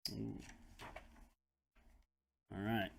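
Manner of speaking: plain reading voice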